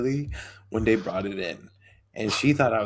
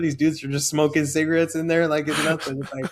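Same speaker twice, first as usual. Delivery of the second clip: ordinary talk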